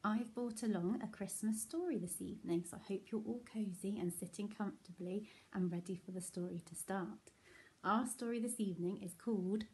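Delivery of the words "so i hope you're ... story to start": soft fast whisper. Real, not acted. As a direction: draw them in softly. Settling children cosily.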